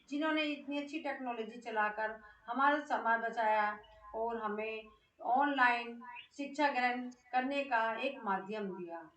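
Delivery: normal talking voice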